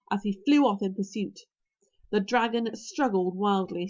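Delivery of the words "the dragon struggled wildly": ordinary delivery, nothing marked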